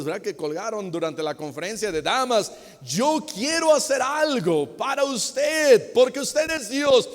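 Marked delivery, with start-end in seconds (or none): 2.8-7.1 loud, high-pitched declaration of devotion; fervent offer. Acted. Believer at God's door offers to serve, fervent raised voice. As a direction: proclaim it with raised, insistent fervour.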